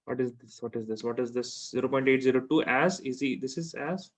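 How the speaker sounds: ordinary delivery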